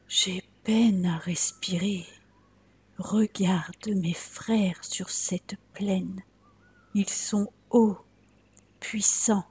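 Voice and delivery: faint whisper of a sickly little tree; a weak tree struggling for air, whispering; feeble tree whispering. Acted. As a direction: whisper as if each breath costs.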